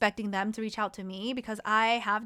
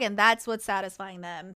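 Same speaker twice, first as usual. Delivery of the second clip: ordinary talk, nothing marked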